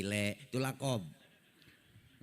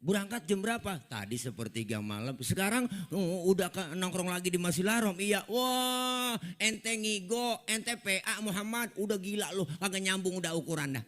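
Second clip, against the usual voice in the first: mocking heathen; 7 semitones higher than usual. Abu Jahal mengejek Nabi.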